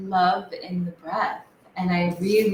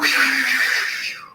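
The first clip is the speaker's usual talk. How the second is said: imitated forceful sniff; 7 semitones higher than usual. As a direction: snort the air in hard like a dragon. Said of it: "and then I went".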